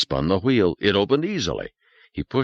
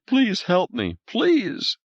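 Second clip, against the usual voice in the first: high, tearful begging; sobbing plea; 13 semitones higher, much slower than usual. A narrator's reading. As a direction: beg through sobs. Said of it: "sobbing hysterically".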